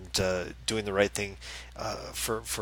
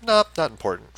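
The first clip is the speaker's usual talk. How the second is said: raised, loud, brusque brush-off; mock dismissive editors; 8 semitones higher, 7 dB louder than usual. Editors rejecting the author's chapter, mockingly dismissive. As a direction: imitate editors waving it away.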